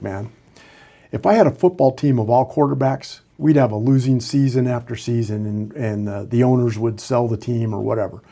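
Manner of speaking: usual speaking voice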